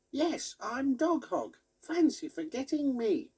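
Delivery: high squeaky toy pig, put out. A narrator's reading. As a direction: play the knitted pig toy, a bit offended. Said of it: "Biscuits made Dog Hog say".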